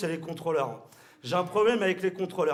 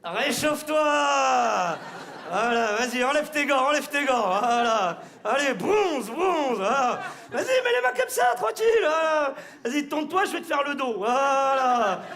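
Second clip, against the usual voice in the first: mocking shout; 6 semitones higher, 4 dB louder than usual. Mockingly warming himself on a smile.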